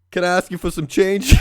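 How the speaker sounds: lightly imitated sheepish ask; high pleading ask. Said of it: "He's like".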